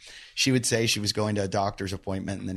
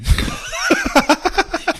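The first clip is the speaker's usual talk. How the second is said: burst of loud high laughter; 6 dB louder than usual. Real, not acted. Laughs at "She's Russian.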